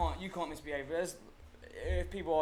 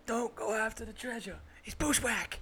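higher Southern American voice; American accent movie quote; 9 semitones higher than usual. Retold or imitated. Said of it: "I'm busting out "Oh Brother, Where Art Thou" quotes".

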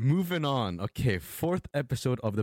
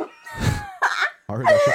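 sudden high squeak; 8 dB louder, slower than usual. Real, not acted. Squeak of disbelief.

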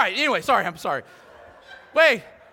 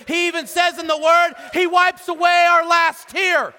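louder and higher, rising preaching climax; full-force preaching; 7 semitones higher, 8 dB louder than usual. Acted. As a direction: build it up to a shout of hope.

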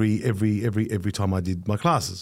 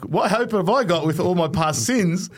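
retold tearful despair; 6 semitones higher than usual. Student despairing beside his pious Arabic teacher, retold tearful despair. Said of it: "I'm started to cry".

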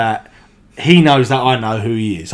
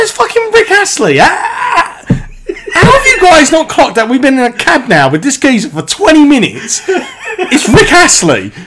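exasperated outburst; 7 dB louder than usual. Retold or imitated. Narrator to mates who missed the star for twenty minutes, shrieked disbelief.

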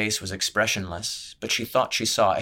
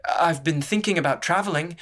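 announced; 8 semitones higher than usual. Acted. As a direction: announce it to pull attention away. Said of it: "Amatha announced".

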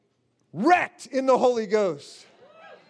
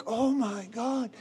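retold dread; 7 dB quieter, slower than usual. Retells awe at seeing the reaper.

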